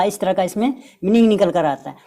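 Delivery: usual speaking voice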